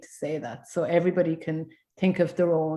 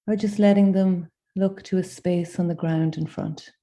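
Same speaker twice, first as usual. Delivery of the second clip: gentle guided meditation with long pauses; unhurried invitations, each left to settle in silence. Acted. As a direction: guide them inward, phrase by phrase.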